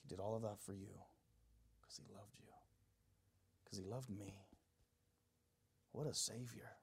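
very soft and low, near whisper; almost whisper it in awe; intimate, tender murmur; hushed reverence. Real, not acted. Preacher moved by Christ's love.

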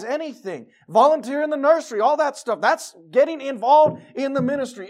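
full-voiced sermon preaching. Real, not acted. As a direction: preach it to the congregation. Preaching in church.

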